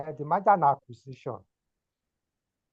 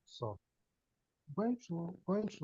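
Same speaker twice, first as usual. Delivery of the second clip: faint, far-off voice on a bad line; barely audible, as if far from the mic; 2 semitones higher, 10 dB quieter than usual. Real, not acted. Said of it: "Your voice is very faint".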